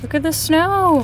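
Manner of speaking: bright excited call to the dog; look, snow!; pointing out snow with a lift. Real, not acted.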